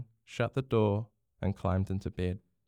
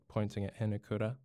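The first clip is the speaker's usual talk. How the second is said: usual speaking voice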